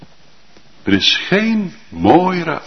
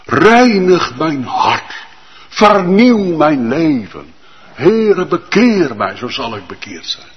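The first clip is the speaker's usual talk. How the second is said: raised, pleading voice of prayer; fervent prayer; 6 semitones higher than usual. Acted. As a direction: pray it aloud with fervour. Preacher voices a sinner's prayer. (vurig smeken in gebed)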